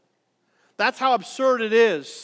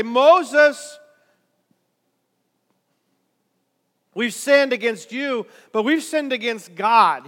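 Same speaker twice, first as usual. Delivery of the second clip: pleading confession; 3 semitones higher, 8 dB louder, slower than usual. Acted. People confessing to Moses.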